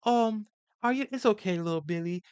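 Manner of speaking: put-on high, soft, babying voice like a nursery teacher; soft coddling voice. Mocking how therapists talk to men, in a high, soft coo. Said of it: "psychology ... spoken to like".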